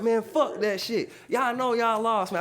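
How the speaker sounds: high, loud angry outburst; tripping out. Retold or imitated. Cast member going off on housemates who lost, high angry outburst. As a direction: go off on them, stretched out and high. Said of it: "I just started tripping".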